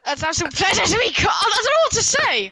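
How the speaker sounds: flustered, rushing and pitching up; jittery, words tumbling out high; nervous. Real, not acted. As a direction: caught on the spot, babbling nervously. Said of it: "you'd get all nervous".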